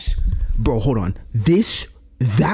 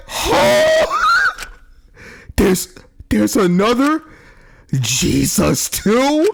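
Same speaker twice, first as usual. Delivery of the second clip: shocked outcry; 11 semitones higher, 4 dB louder, much slower than usual. Retold or imitated. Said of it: "They're like".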